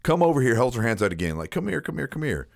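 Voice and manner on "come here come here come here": high, soft teasing call; goofy coaxing. Retold or imitated. Woman on her countertop luring her date over, goofy and coaxing. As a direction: coax him over playfully, voice up and hushed. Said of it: "like, kinda goofing off".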